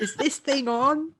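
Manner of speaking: put-on high, slow old-timer voice; confused old man. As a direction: play the baffled old fella. Joins in playing confused old man.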